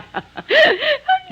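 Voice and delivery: high, helpless peal of laughter; laughing. Acted. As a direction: laugh uncontrollably at the other person. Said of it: "Why are you laughing?".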